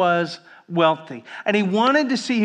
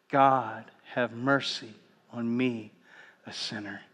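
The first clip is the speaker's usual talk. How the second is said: much softer and lower, slow, fading on each phrase; breath prayer; 6 semitones lower, 7 dB quieter, slower than usual. Shown as a demonstration. Preacher demonstrating the ancient breath prayer softly. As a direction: breathe it out like a heartbeat. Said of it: "I invite you into this breath prayer".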